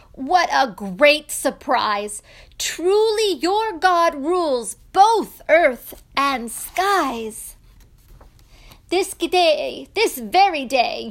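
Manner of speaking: loud, grand royal proclamation; exultant, emphatic praise; jubilant king. Acted. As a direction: declare it to the whole kingdom.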